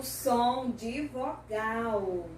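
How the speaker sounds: very slow, syllable by syllable; drawn-out teaching delivery; slow emphasis. Real, not acted. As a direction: stretch the key term for learners. Teacher stressing the key term.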